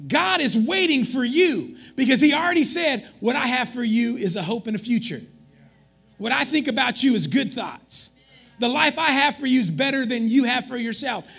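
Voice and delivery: loud, high preaching build to a shout; full-force climax for the congregation. Acted. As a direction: preach it out to the rafters.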